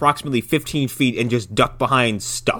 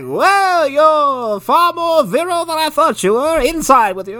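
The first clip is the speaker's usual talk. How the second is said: high, loud flirty granny; old lady voice; 11 semitones higher, 4 dB louder than usual. Retold or imitated. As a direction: flatter him like a creepy old woman. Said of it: "She's like".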